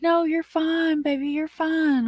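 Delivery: high, light mock-soothing boyfriend voice; imitating her boyfriend's breezy reassurance; boyfriend reassuring. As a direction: sweet-talk her like a calm surfer guy. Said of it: "So he's like".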